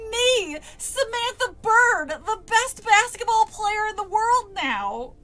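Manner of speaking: high, loud, slow and tearful outrage; anger bursting out. Acted. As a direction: wail it, furious and near tears. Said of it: "my anger bursting out".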